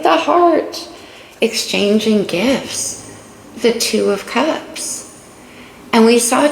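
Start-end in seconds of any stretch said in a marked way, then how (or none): 0.0-0.9 the heart swooped up high; gushing about the heart. Retold or imitated. Water sign gushing over the heart card, high and swooning. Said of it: "So I'm like".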